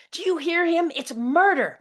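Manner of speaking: high, loud hysterical scream; mom screaming. Retold or imitated. Mother screaming murder at her daughter. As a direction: scream the accusation. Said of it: "my mom screams".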